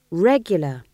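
stern. A narrator's reading. Young witch insists on regular meetings, sternly. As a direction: add it as a firm reproof. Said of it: "said Magrat sternly".

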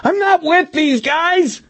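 slow, high screamed denial; drawn-out panicked screech; Milosevic screaming. A narrator's reading. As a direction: scream it out very high, desperate to be believed. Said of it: "Milosevic screamed".

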